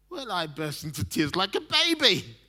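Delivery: ordinary talk, nothing marked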